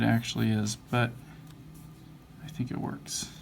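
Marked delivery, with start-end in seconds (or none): 2.4-3.3 mumbling. Real, not acted. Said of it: "Forgive me if I'm mumbling".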